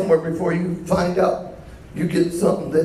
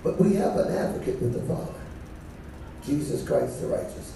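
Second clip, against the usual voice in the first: hushed reverent; 8 semitones lower, 8 dB quieter than usual. Acted. Preacher drops voice.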